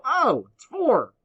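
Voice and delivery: high, loud, drawn-out oh of discovery; light up as the answer suddenly clicks; surprised realization. Real, not acted. Teacher discovering the answer is four, loudly surprised.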